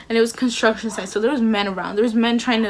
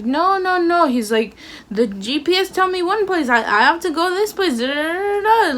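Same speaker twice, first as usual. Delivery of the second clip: mock Uber driver; 7 semitones higher than usual. Imitating the Uber driver refusing her, pitched high and insistent. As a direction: mimic the stubborn driver's broken English, pitched up. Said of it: "he's speaking, like, a different language".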